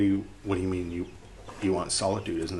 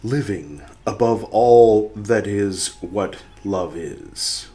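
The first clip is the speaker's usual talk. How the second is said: slow solemn quote reading; 9 dB louder, slower than usual. Acted. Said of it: "This is in quotes".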